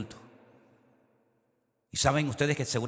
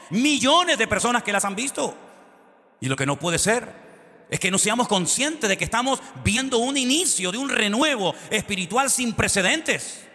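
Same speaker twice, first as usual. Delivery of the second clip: loud, high, driving preacher's voice; fiery pulpit proclamation; full-force preaching; 10 semitones higher, 7 dB louder than usual. Acted. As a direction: preach it out to the whole congregation.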